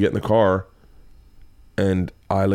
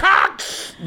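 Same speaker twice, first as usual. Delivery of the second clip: high, hammed-up pretend swear; mock cursing kid. Imagined daughter cursing alone in room, mock yell. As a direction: blurt it like a kid cursing in secret.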